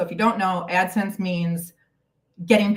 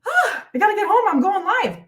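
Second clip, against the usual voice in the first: high, rushed retold panic; half-playful flustered rush; mock panic; 10 semitones higher, 3 dB louder than usual. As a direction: replay the frantic dash home, pitched up. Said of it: "and I'm like".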